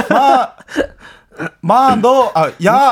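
mock Busan quarrel. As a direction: play the rough Busan tough guy.

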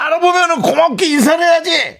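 high, loud mimicked shout; retold scolding shout. Hiker imitates a passerby scolding him, shouting high. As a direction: imitate an indignant woman yelling out. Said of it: "이러는 거야".

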